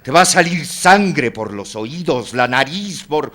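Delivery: loud, high, dramatic foretelling; vehement grim prophecy. Acted. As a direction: hurl the gruesome prediction at him. Gruesome prophecy of Oscar's death. (profecía macabra, fuerte y vehemente)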